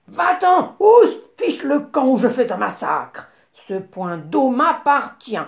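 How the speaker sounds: cri aigu et fort du babouin; chasse-le en hurlant; voix furieuse. A narrator's reading. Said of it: "Une voix furieuse se fit entendre". (cri furieux du babouin contre le phacochère)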